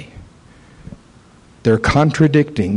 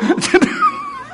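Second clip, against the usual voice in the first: mocking laugh. Demon laugh retold.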